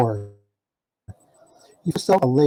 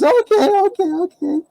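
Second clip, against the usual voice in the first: much higher, sing-song okays in her voice; mock Faley voice. Teller imitates Faley agreeing sweetly, high and sing-song. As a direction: chirp okay like the local girlfriend.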